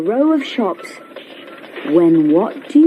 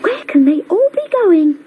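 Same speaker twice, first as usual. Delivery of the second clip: much higher, quick curious car; Brum wondering; 10 semitones higher, faster than usual. A narrator's reading. Brum curious about the family trip, high and quick. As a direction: wonder aloud, eager and quick. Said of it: "thought Brum".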